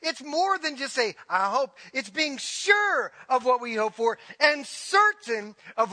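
high, loud, hammering preacher's cry; fiery preaching. Acted. Pastor urging the congregation toward faith, preaching at full force. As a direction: preach it out to the whole church.

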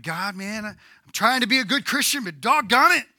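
loud, high complaining to God; mock the frustrated believer's moaning. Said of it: "They're like".

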